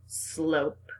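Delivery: ordinary talk, nothing marked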